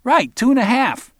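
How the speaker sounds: high, loud, cocky punk; emphatic cocky agreement. Acted. Barry agrees with Arnold, high loud cocky voice. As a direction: jump on the correction loudly.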